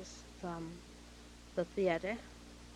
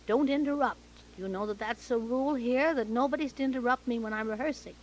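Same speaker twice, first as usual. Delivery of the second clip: loud, raised, emphatic reprimand; retold scolding; 4 semitones higher, 7 dB louder than usual. Director scolding actress for interrupting rehearsal, sharp rebuke. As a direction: lay down the rule loudly and crossly.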